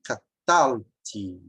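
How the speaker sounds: catalti very slow, long strong TAL; low, drawn-out catalti with weight on TAL; stressed syllable demo. Shown as a demonstration. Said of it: "que é forte. Então, vai ser".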